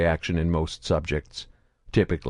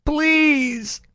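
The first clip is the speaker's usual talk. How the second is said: high, loud whining beg; high mewling whine; 5 dB louder than usual. A narrator's reading. Grotto begs Saren in a high whine. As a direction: whine pitifully. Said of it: "begged in a high-pitched, mewling whine".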